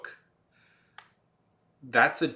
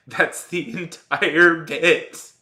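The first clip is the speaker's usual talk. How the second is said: laughing; 6 semitones higher, 9 dB louder, much slower than usual. Real, not acted. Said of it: "laughing at own explanation".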